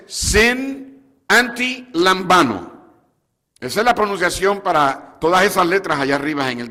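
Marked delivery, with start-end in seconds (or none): 0.1-3.0 sin anti lambano spoken slowly, each part set apart; Greek pronunciation. Shown as a demonstration. Preacher showing the congregation the Greek word, slow and deliberate. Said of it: "La pronunciación es".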